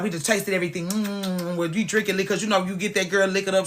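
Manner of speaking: ordinary talk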